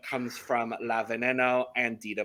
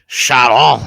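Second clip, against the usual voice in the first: "Sharon" as "Sha-RON", French-Spanish accent, much louder; 14 dB louder than usual. Shown as a demonstration. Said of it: "It's kind of French, kind of Spanish".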